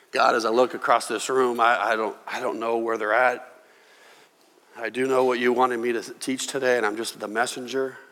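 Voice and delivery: low, hushed prayer voice; closing prayer. Real, not acted. Pastor praying quietly for his listening congregation. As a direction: pray softly over the room.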